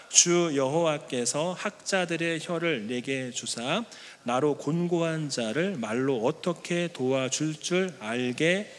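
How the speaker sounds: scripture reading. Acted. Preacher leads the congregation reading Isaiah, slow and solemn. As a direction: read it aloud slowly together.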